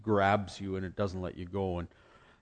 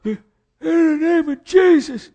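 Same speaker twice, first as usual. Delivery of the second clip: much higher, loud, slow, with a crying edge; high wailing prayer; 12 dB louder, slower than usual. Retold or imitated. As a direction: wail it like a fervent preacher. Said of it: "you can go".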